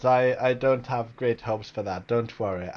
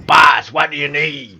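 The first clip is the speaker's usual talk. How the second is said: loud mob goon shouting to the boss; mafioso voice; 9 dB louder than usual. Acted. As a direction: bellow it like an eager mafia henchman. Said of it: "It's gonna be a mafioso boy".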